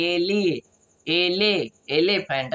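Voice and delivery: "एलिफेंट" built up as e-li, e-le, elephant; teacher's step-by-step "एलिफेंट"; sounding out. Shown as a demonstration. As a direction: piece together "एलिफेंट" with pauses. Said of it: "तो हम क्या बोलते हैं?".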